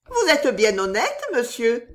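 polite soubrette. A narrator's reading. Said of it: "fit la soubrette en esquissant une révérence". (soubrette polie, voix plus aiguë)

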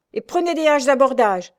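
lieutenant shouting an order; commanding order. A narrator's reading. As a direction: drive the men on. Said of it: "commanda le lieutenant".

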